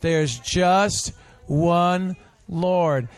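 each word drawn out, "just" leaned on; teacher modelling the line for a children's group; slow emphatic. Shown as a demonstration. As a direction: spell it out slowly for the kids. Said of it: "Everybody say that".

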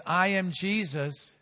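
ordinary talk, nothing marked

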